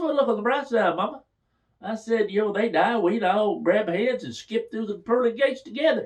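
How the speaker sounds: high, cheeky teasing. Retold or imitated. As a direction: tease her with a high voice. Teasing reply to mother.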